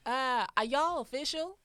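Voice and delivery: slow, loud mock-American accent. Shown as a demonstration. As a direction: draw out the question in a put-on American twang. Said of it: "I think my American accent is sick".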